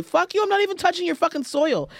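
mocking rant at border officers; indignant outburst. Real, not acted. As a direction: fire it off, high and fed up. Ranting about Heathrow immigration.

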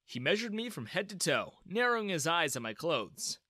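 ordinary delivery

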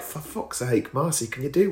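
exasperated under the breath; muttered annoyance. Retold or imitated. Fed up with the neighbour, muttered grumble. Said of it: "British people who are like".

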